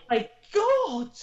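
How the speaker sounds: high, loud, slow and drawn out; thrilled disbelief. Retold or imitated. Women learning the doors are open, thrilled cry. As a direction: let the joy burst out slowly. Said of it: "We were like".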